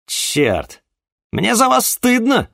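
high, loud scolding; angry shout. Acted. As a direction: shame them loudly. Juan scolds his soldiers.

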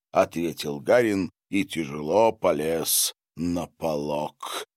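ordinary delivery

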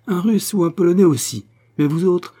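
angry woman ranting at Englishman, high and loud; scornful rant. Acted. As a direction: sneer at the English, voice up. Sophie's rant against the English.

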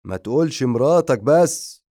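high, loud angry rebuke; angry vampire. Acted. The vampire rebuking the cat about his wife, loud angry rebuke. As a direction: cut him off, offended. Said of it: "قال الفامباير بغضب".

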